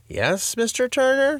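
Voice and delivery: tired, high principal voice; weary principal. A narrator's reading. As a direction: sigh it out, worn thin. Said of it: "her tone slightly weary".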